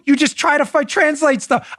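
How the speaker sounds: ordinary talk, nothing marked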